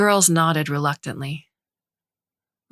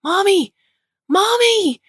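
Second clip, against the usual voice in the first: put-on high, loud happy cry of children; joyful shouting; 6 dB louder, slower than usual. A narrator's reading. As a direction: call out with delight.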